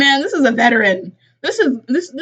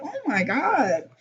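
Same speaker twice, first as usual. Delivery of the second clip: high, drawn-out "oh my God"; mock shock; 5 semitones higher, 4 dB quieter, slower than usual. Host recalling seeing the dog killed, mock shock. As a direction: stretch it out in retold disbelief. Retold own shocked reaction.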